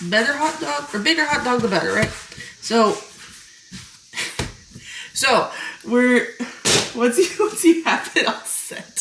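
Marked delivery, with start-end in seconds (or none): 2.6-9.0 laughing. Real, not acted. Laughing mid-sentence at own joke.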